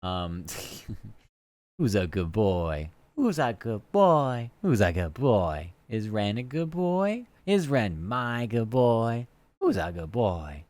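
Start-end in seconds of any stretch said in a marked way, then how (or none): 1.8-10.6 slow sing-song dog-praising voice; pet talk. Pretend. Teasing Ren in chat, slow baby-talk to a dog. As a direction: coo at him like a pet dog.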